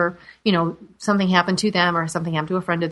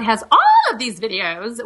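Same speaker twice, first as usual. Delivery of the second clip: ordinary delivery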